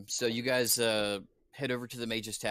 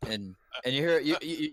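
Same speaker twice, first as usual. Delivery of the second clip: ordinary talk, nothing marked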